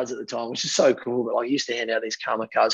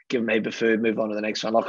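ordinary delivery

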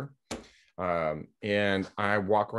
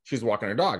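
usual speaking voice

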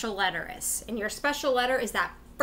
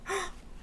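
mock gasp; 9 semitones higher, 10 dB quieter than usual. Teacher gasps.

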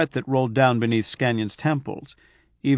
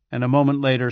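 plain reading voice

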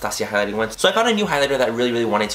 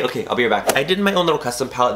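normal talking voice

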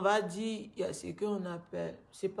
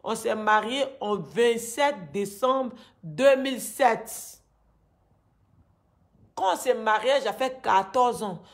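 raised, agitated pleading; upset; 2 semitones higher, 7 dB louder than usual. Real, not acted. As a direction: let the anger pour out louder. Angry account of forced marriage.

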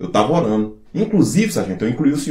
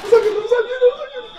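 squeaky mocking voice; 13 semitones higher than usual. Soldier mocking sergeant.

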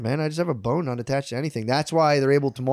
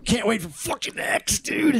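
mock excitement; 10 semitones higher, slower than usual. Excited praise of X.

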